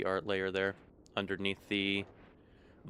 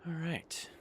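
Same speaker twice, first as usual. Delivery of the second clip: whisper; 9 semitones higher, 6 dB quieter than usual. Real, not acted. Gamer alone, murmuring to self.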